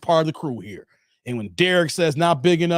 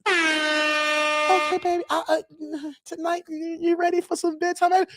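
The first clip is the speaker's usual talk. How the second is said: high, sweet put-on wife voice; mock wife voice; 12 semitones higher, slower than usual. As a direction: play the cooing wife, pitched way up. Acted couple, wife's reply.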